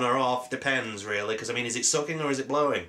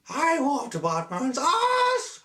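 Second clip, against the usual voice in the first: "I whooped Batman's ass." sung out in a mock Chinese accent, much higher and slow; 13 semitones higher, much slower than usual. Shown as a demonstration. Granting a fan's request, high slow mock Chinese accent. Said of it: "sound like in a stereotypical Chinese accent".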